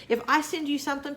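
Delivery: ordinary talk, nothing marked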